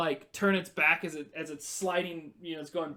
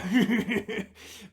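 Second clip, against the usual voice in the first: high, loud cackle; evil goblin laugh; 6 semitones higher, 5 dB louder than usual. Acted. Goblin captor mocking the heroes, evil cackle. Goblin gloating over hostage.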